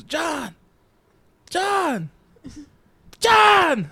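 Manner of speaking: high imitated call, growing to a shout. Classmate calling from way in back, building shout.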